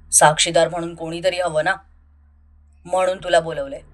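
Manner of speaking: low, unbothered male voice; calm low Samrat. Acted. Samrat dismisses his friend's worry, low and unbothered. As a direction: brush the question off coolly.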